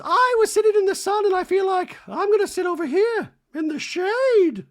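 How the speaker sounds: very high, loud mock Dorit voice; a shrieking hammed-up Dorit. Recapping Dorit moving to the shade in a very high mock voice.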